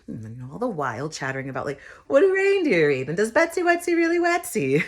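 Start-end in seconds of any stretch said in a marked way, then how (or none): 2.1-4.8 put-on kid voice. Kids chattering at Santa.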